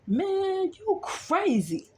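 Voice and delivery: high, slow mock voice of the driver; imitating truck driver. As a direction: stretch out "you crazy" in a put-on high voice. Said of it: "he was like".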